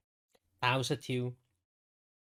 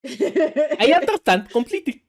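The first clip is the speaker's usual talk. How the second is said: laughing; 12 semitones higher, 11 dB louder than usual. Acted. Mock agreement, laughing.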